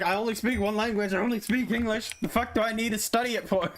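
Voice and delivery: mock bratty student, pitched high; whiny student. Student mocking English class, in a high bratty whine. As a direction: whine it like a smug kid who only speaks English. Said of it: "it's like".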